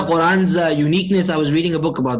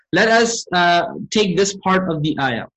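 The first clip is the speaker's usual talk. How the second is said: ordinary delivery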